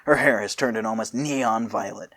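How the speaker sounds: ordinary delivery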